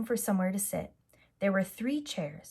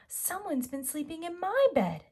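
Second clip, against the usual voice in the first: Mommy Bear voice; 8 semitones higher than usual. Acted. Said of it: "she said".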